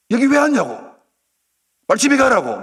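high, loud angry yell. Acted. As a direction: shout him away. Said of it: "고함을 지르면서". (고함치며 쫓아내는 소리)

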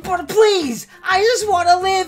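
high, loud, begging whine; mock pleading. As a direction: beg for your life, hammed up. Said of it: "I'm just here like".

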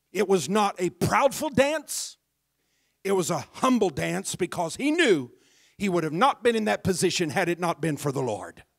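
loud, fervent preaching; full-throated sermon; preaching at full force. Acted. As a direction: preach it out with fire.

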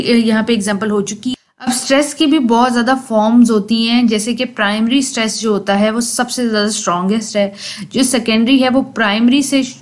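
ordinary talk

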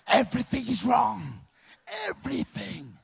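loud slow everything is wrong; emphatic punchline. Acted. Comic telling the audience what nothing means, loud and slow. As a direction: lean hard on everything.

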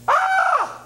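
put-on sudden piercing high child scream; child's scream. Mimicking a toddler screaming on the plane, piercing high shriek. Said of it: "doesn't need to hear you scream".

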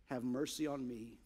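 a beaten man barely voicing his confession; hushed, humble prayer for mercy; humble sinner's plea. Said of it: "he beat his chest and said".

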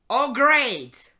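loud mock delight; sarcastic cheer. Reading lyrics to audience, loud sarcastic cheer. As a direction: cheer through gritted teeth.

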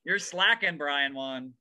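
mock scolding. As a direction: needle yourself like a pushy coach. Mocking pep talk in mirror.

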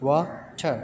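"water" split into two separate beats; syllable by syllable. Shown as a demonstration. Teacher showing pupils the syllables, "water" broken in two. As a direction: say "water" with a gap between wa and ter. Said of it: "there are two syllables in water".

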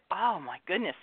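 ordinary delivery

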